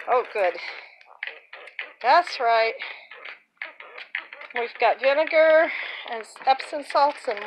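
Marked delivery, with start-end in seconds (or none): none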